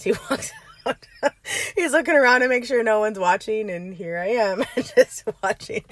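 talking through genuine laughter; real laughing. Real, not acted. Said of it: "I'm laughing because".

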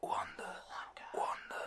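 breathy whisper of Wanda; whispered name. Retold or imitated. Vision whispering Wanda to his co-host. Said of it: "I love that".

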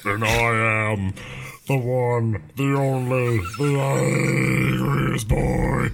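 slow drawn-out put-on voice; thrown voice. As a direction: drag out each word like a villain. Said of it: "throw our voices".

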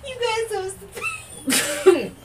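high, slow words lost in laughter; can barely talk for laughing; squealing laugh through the words; laughing hard. Real, not acted. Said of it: "laugh cry".

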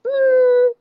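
mock his hesitant counter-offer in a high voice; imitated reluctant husband. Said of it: "he'd be like".

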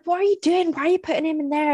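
high, fast, stressed challenge; stressed telling-off. Retold or imitated. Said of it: "And I was getting so stressed out".

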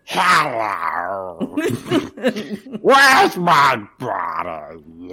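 lightly voiced slow alien brain; Krang voice. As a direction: drag the words out slowly like an alien brain. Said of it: "Hello, Krang".

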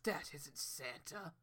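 low, soft, slow and ominous. Retold or imitated. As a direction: deliver bad news, darkly. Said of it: "Rudolph shows up and squints".

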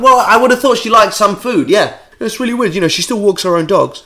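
high, exasperated mocking; sarcastic outburst. Answering celebrities' dull trivia, sarcastic and exasperated.

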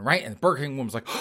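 ordinary delivery

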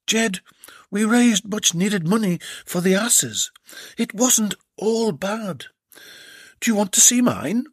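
high, cheeky lady; a saucy offer; Belinda's voice. Acted.

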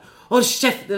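loud high furious shout; angry yell. Retold or imitated. Yelling at the teens on the bus, furious shout. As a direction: bark it at the bullies. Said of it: "skriker så här".

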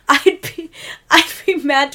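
a mock weird voice; hammed-up odd delivery; weird line reading. A podcaster mimicking a guy from school, lightly weird. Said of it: "He says it in the weirdest voice".

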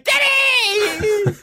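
very high, drawn-out mock scream; hammed-up shriek on waking from a nightmare. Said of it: "And I wake screaming".

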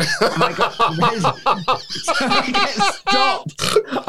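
high, giggling words between laughs; laughing through words. Real, not acted. To the hosts about the airport, laughing hard. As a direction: laugh helplessly at your own story. Laughing while telling embarrassing story.

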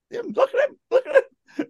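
piercingly high mock-excited voice; imitated excited squeal. Retelling first-years watching the TV, imitated excited squeal. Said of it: "they just kept screaming".

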